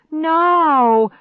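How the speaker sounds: high, loud drawn-out cry; desperate shout. A narrator's reading. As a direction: shout in protest. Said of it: "I shouted".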